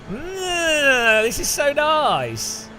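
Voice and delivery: high, slow, exaggerated yum; hammed-up delight, drawn right out; mock relish. Mocking a salt lover tasting the ocean, mock relish.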